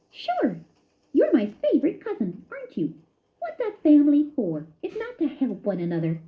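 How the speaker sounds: high cheerful squeak; a happy cousin eager to share; lightly squeaky, glad voice; squeaking happily. A narrator's reading. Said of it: "Ced squeaked happily".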